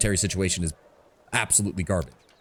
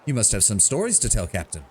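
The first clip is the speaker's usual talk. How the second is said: ordinary talk, nothing marked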